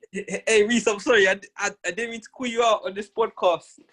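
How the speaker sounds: high, pleading mock sorry; mock apology. Teasing his friend with an imagined apology, mock pleading. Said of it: "Then you'll be like".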